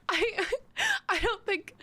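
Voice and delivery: high, helpless laughing through words; words breaking up into laughter; cracking up. Real, not acted. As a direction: try to talk through real giggles. Cracks up at accent attempt.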